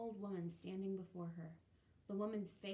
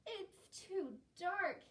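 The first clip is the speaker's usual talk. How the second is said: put-on high, loud, slow child voice; whiny little boy; 10 semitones higher, 5 dB louder, much slower than usual. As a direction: drag it out like a scared small boy. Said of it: "Hillel explained".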